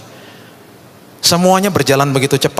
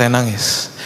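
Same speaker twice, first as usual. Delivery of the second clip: ordinary delivery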